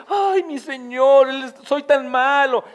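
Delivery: mock weeping. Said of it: "no fue de que andemos llorando por ahí".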